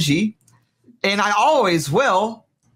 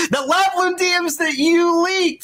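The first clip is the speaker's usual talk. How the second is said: shouted accusation, pitched way up; real outburst; 11 semitones higher than usual. Real, not acted. Arguing, accusing co-streamer over DMs.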